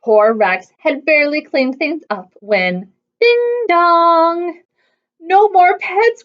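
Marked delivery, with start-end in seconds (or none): 5.2-6.2 groaning. A narrator's reading. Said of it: "groaned Bob".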